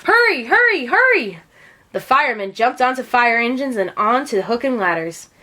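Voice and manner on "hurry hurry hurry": urgent shouting. A narrator's reading. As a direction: drive everyone to move now. Firemen rushing to a fire.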